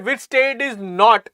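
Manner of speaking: slow, with "not" leaned on at the end; stress on not. Shown as a demonstration. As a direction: draw out "not" to flag it. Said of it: "emphasis on the word not".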